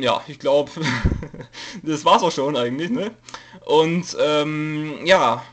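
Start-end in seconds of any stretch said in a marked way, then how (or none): none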